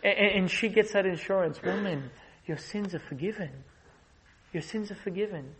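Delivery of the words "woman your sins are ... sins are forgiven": soft, hushed Jesus comforting; Jesus gently reassures the forgiven woman; tender comfort. Acted. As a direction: speak softly to calm a weeping woman.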